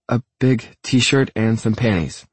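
shy woman mustering courage; bravely. A narrator's reading. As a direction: answer slowly, braving embarrassment. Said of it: "she tells me bravely".

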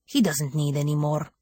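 level guardian refusal; even. A narrator's reading. Guardian protecting his master from company, even-toned. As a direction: keep it steady and unyielding. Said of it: "the Guardian replied evenly".

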